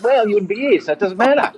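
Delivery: high-pitched, matey brush-off; mock dismissive. Retold friends brushing off his worry.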